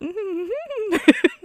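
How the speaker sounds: nasal laugh demo. Retold or imitated. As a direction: giggle through your nose on purpose. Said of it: "sounding something like this".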